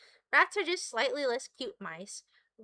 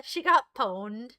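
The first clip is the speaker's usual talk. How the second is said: normal talking voice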